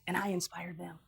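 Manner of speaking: ordinary talk, nothing marked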